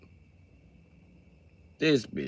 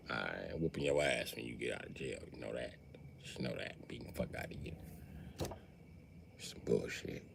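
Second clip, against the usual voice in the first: much lower, soft muttered grumble; muttered threat; 10 semitones lower, 21 dB quieter than usual. Retold or imitated. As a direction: grumble threats under your breath. Suspect mutters threats to cop.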